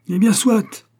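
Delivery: high, loud angry exclamation; furious cry. A narrator's reading. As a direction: burst out at the son in fury. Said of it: "parvenu au dernier degré de la fureur". (cri de fureur, aigu et fort)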